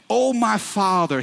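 a son crying out to his father; retold bitter cry; loud bitter cry. A narrator's reading. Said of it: "he cried out with a loud and bitter voice".

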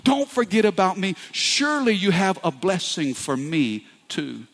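high, aching plea to God; a soul begging not to be forgotten; pleading prayer. Pretend. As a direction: voice the hidden longing of the soul. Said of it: "a part of you that says".

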